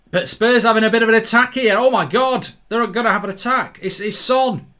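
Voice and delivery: high, loud mock-amazed commentator; excited commentary. Real, not acted. Commentator amazed Spurs have an attack, excited. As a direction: raise pitch and volume as the attack breaks.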